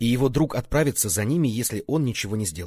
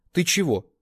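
surprised, higher and louder; удивлённо; 8 semitones higher, 5 dB louder than usual. A narrator's reading. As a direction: ask it with real surprise. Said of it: "удивлённо спросил тот". (удивлённый вопрос, выше и громче)

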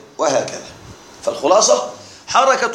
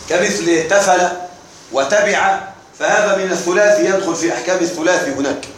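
ordinary talk

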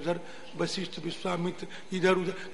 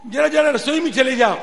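loud, high acted request; guru asking a chore; 7 semitones higher, 9 dB louder than usual. Guru sending his disciple to the kitchen, raised request. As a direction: call the pupil over with raised voice.

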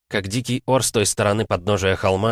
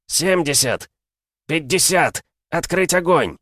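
barking battle orders; 9 semitones higher, 3 dB louder than usual. Acted. Sergeant barks battle commands.

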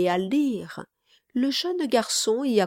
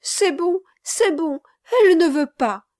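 put-on high, excited little boy's cry; small boy crying out, high and loud; child rejoicing through tears; 9 semitones higher, 6 dB louder than usual. A narrator's reading. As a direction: exclaim with childish relief. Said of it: "s'écria le petit garçon, se réjouissant".

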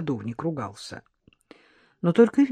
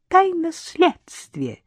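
higher, slow, teasing giggle; giggling; 12 semitones higher, 4 dB louder, much slower than usual. A narrator's reading. Maid giggles, keeping the investigation secret from the nanny. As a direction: tease her with a secret. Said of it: "хихикнула собеседница".

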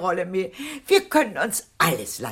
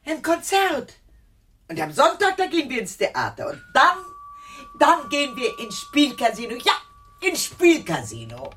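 excited; 7 semitones higher, 3 dB louder than usual. Acted. Aunt gushing about outings.

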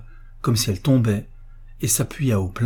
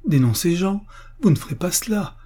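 much higher, horrified protest; appalled cry; 8 semitones higher than usual. A narrator's reading. Sister hearing her brother will be denounced, appalled cry. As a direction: cry out in disbelief. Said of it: "crie Lucienne avec un geste de recul".

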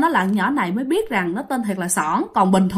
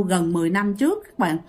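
ordinary talk, nothing marked

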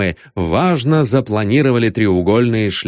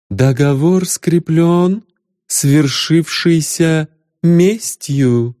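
slow velvety goddess voice; 6 semitones higher, much slower than usual. Acted. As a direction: speak slow and solemn like a goddess. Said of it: "бархатный голос богини". (бархатный голос богини)

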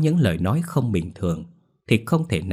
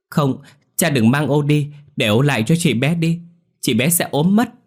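put-on crying child protest; 7 semitones higher, 5 dB louder than usual. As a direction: whine and beg like a struggling child. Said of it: "nằng nặc cựa quậy khóc lóc". (bé gái khóc lóc nằng nặc, giọng cao và to)

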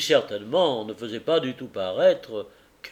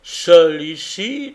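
slow, louder questioning echo; echoing in doubt; 3 semitones higher, 10 dB louder, much slower than usual. Acted. Tackleton repeats the carrier's words, slow and questioning. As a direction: throw his words back, doubting.

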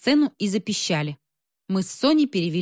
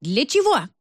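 high indignant bark, only moderately louder; narrated roar; 6 semitones higher, 3 dB louder than usual. A narrator's reading. Woman roaring at the smoke machine man, restrained high bark. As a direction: bark the question in outrage. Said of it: "рявкнула во всю мощь".

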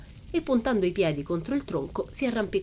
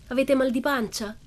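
higher, bouncy mock concern; cheeky question; 7 semitones higher than usual. A narrator's reading. Pippi joking with the frightened children, cheeky. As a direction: ask it like a cheeky little girl.

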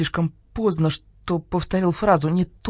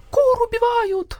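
high, drawn-out hen cry; panicked wail; slower than usual. A narrator's reading. All the hens cry out together, high wail. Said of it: "завопили все хором".

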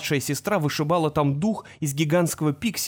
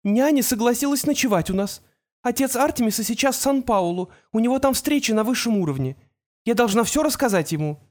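high worried female voice; worried mother; 7 semitones higher than usual. A narrator's reading. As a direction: talk it out, anxious and high. Mother on phone.

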